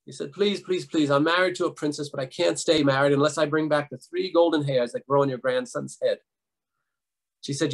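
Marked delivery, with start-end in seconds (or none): none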